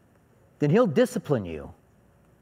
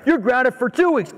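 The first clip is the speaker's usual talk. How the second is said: exasperated dad; 7 semitones higher than usual. Retold or imitated. Escalating father punishment.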